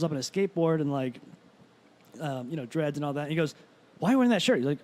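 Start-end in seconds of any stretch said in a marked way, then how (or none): 4.0-4.6 higher, louder accusing question; indignant challenge. Retold or imitated. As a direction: confront him over the shirt. Skateboarder confronting the comic.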